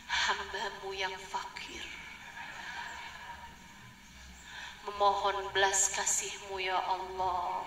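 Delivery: low, slow, solemn mock prayer voice; overly formal prayer. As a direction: drag out a stiff, pompous supplication. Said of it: "Nggak usah yang kayak". (doa yang dibuat-buat, pelan dan rendah)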